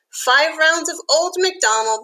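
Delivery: ordinary delivery, nothing marked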